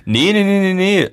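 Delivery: high-pitched, insistent string of no's; hasty denial. Real, not acted. Host correcting co-host's wrong conclusion, hasty high-pitched denial. Hastily denies being against it.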